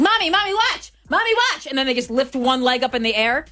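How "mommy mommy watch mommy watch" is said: put-on high, excited little-kid voice; high-pitched mock whine for mommy's attention; kid begging for attention. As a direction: imitate a child pestering mom to watch. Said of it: "when your kid looks at you, he's like".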